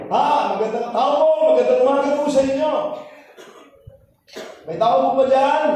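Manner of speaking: calling out brightly and loud at the door; loud greeting call. Retold or imitated. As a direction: call loudly into the house as if unsure anyone's home. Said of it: "Lakas ng boses ko".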